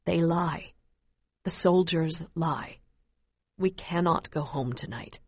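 low, soft father's voice; father speaks softly to his crying child. Acted. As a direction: break the hard truth gently. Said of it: "says softly".

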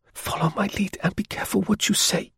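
high, soft woman's whisper. Acted. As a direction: whisper a secret warning. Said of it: "she managed to whisper".

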